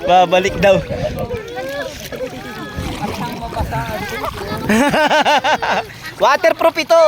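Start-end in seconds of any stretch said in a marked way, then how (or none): 0.8-6.2 long real fit of laughter. Real, not acted. Uncle amused by scared nephew, long laugh. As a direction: keep laughing in rapid pulses. Adult amused at frightened child.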